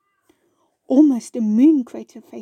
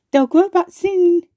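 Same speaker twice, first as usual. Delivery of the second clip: higher, louder call of encouragement; 7 semitones higher, 6 dB louder than usual. A narrator's reading. Friends calling encouragement to the wobbly-toothed flower. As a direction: call it out bright and high. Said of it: "they called at him".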